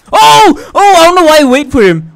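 fast, high panicked outburst; being chased. Real, not acted. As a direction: scold yourself while fleeing. Startled, berating self while chased.

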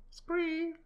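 squeaky high sound effect; high effect noise. Acted.

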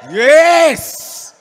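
loud, high cheer; a loud excited yes with the audience; excited shout. Real, not acted. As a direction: whoop along with the crowd. Cheering with crowd.

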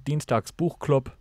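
ordinary delivery, nothing marked

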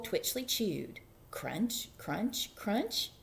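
ordinary delivery, nothing marked

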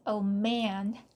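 usual speaking voice

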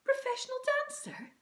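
very high, hushed-eager mum voice; breathless mum. A narrator's reading. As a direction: squeak it out on one held breath. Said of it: "she took a deep breath".

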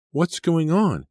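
high, loud, alarmed question; urgent demand. A narrator's reading. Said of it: "she demanded".

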